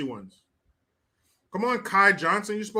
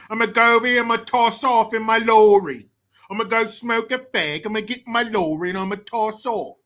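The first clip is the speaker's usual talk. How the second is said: higher, hammed-up British slang; mock British accent; 3 semitones higher, 5 dB louder than usual. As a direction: rattle off slang in fake British. Stringing British slang together in accent.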